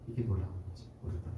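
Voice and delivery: fast whispered aside; hushed, awed whisper. Real, not acted. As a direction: breathe it out in awe. Closing thought on the Spirit's power.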